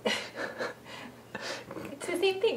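breathy unvoiced aside; whisper. Real, not acted. Mom aside about baby's sound.

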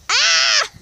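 high sudden shriek; startled shriek. Real, not acted. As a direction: yelp as snow hits your face. Snow flies into her eye.

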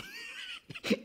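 a quick high laugh breaking out; a short amused laugh. Real, not acted. Listener laughs at the old man.

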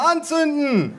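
put-on high-pitched child's cry; little boy calling. A narrator's reading. Cousin's little son wants them lit, high eager call. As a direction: pipe it up high like a small boy. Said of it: "rief der Kleine".

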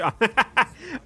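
loud, high burst of laughter; amused laugh. Real, not acted. Streamer told he sounds posh, amused laugh.

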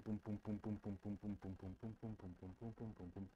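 low, very soft, steady booms; imitated heartbeat. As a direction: thump softly like a beating heart.

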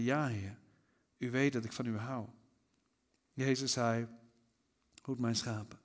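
soft, subdued Bible reading with weighty pauses; solemn scripture reading. A narrator's reading. Jesus questions Simon Peter about his love, read solemnly. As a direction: let each question and answer settle quietly.

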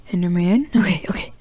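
breathy hushed whisper. Real, not acted. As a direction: whisper it so it won't hear. Spotting enderman, hushed.

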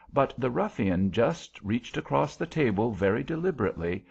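ordinary delivery

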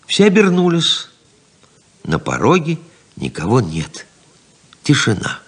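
normal talking voice

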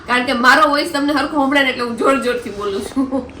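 loud over vegetable sellers' shouts; speaking loudly. Real, not acted. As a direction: raise your voice so the viewers can hear. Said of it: "મારે જોરથી બોલવું પડે છે".

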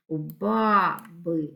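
"у баби" slowly broken as у ба-би; split into syllables. Shown as a demonstration. Teacher dividing words for pupils, "у баби" syllable by syllable. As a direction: separate "баби" into ба, би, drawn out. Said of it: "слова на склади".

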